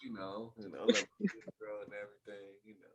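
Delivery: hushed, seductive low murmur; bedroom voice. Pretend. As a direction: croon it softly like a lover. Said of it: "That come to my bedroom voice".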